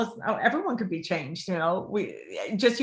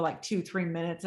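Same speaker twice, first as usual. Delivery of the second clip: ordinary talk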